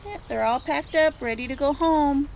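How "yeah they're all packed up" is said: ordinary delivery, nothing marked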